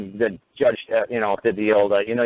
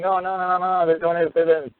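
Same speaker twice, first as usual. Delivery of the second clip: high, frantic panicky protest; hammed-up high-pitched panic; mocking panicked supporters; 9 semitones higher than usual. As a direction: play them as squealing alarmists. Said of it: "They're like".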